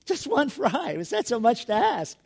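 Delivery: put-on milk it like a sulking kid; mock whining over one fry; pity party. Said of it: "sounding like I'm having a pity party".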